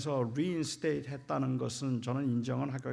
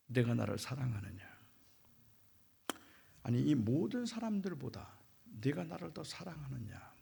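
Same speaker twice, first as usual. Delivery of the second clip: whispered, softer question; hushed question; 2 semitones lower, 5 dB quieter than usual. Retold or imitated. Jesus quietly asking Peter, whispered. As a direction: ask it in a near whisper.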